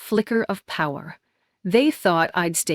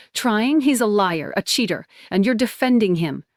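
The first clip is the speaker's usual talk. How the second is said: furious; 3 semitones higher than usual. Acted. Furious outburst.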